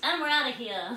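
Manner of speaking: cheeky getaway voice. Pretend. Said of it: "They're like".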